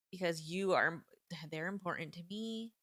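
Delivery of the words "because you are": usual speaking voice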